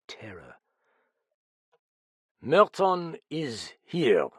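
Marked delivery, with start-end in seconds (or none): 2.4-4.3 loud, high, very slow Frenchman; grave announcement. A narrator's reading. Detective announcing the killer to a frightened room, grave. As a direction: draw out each word portentously.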